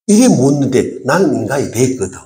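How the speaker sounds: usual speaking voice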